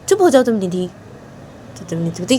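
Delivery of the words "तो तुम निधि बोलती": ordinary delivery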